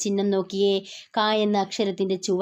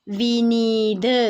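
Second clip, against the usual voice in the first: slow demo reading; much slower than usual. Shown as a demonstration. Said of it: "എക്സാമ്പിളായി നിങ്ങൾ നോക്കൂ".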